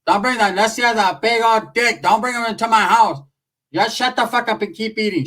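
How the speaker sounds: imitating the dad. Dad yelling at the kids to stop the Ouija talk. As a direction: yell it like a fed-up father at the table. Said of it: "My dad's like".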